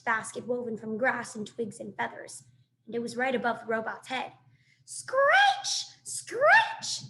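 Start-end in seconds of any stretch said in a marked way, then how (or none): 4.9-7.0 squealed much higher and louder, like a bird; shrill bird cry Screech; robin screech. Acted. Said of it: "said the robin".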